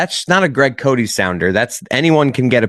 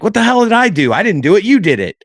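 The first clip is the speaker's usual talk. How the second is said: exasperated protest; 8 semitones higher than usual. Retold or imitated. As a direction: throw up your hands and protest. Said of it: "flailing your arms".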